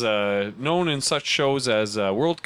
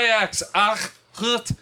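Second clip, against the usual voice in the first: put-on Dutch accent; 9 semitones higher than usual. Mock Dutch scorn.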